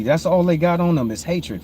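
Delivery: usual speaking voice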